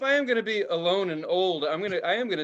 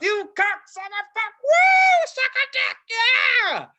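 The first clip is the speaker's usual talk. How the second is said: high-pitched screamed stream of curses; screamed cursing; 6 dB louder than usual. Retold or imitated. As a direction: re-enact cutting loose on a roller coaster, shrieking. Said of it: "all the good stuff came out of my mouth".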